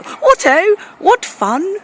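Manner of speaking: high, jolly upper-class squeal; posh schoolboy. Acted. Old boys together at school, high posh glee. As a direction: pipe up like an excited old-school toff.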